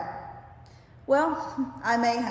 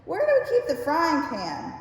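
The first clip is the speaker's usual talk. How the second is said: retold call from the next room, higher and a bit louder; hollering from kitchen; 6 semitones higher, 4 dB louder than usual. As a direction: call out across the house. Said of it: "he hollered out to me from the kitchen".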